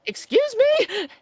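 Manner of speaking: high, slow, drawn-out shock. Retold or imitated. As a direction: say it like you can't believe it. Shocked reply to request.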